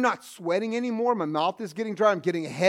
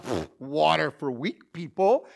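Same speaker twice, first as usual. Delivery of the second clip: mock tough scoff; 5 semitones lower, slower than usual. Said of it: "We're like".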